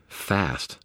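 ordinary delivery

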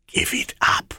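hushed menacing shadow; dark whisper behind you. Acted. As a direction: breathe it out like a shade. Shadow Ammit speaks.

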